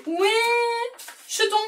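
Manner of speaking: « où » tenu très haut, question étirée; appel joueur. Pretend. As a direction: appelle lentement d'une voix perchée. Devinette ludique posée à sa fille. (appel chantant et enjoué)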